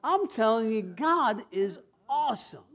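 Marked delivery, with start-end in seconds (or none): none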